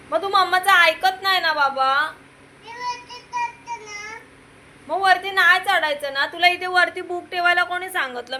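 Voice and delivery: scolding. Real, not acted.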